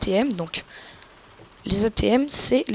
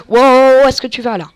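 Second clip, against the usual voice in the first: loud startled wow, then a louder question; startled shout; 3 semitones higher, 11 dB louder than usual. Real, not acted. Gamer startled as a hostage runs off, loud. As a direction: jump at the fleeing civilian. Civilian walks away.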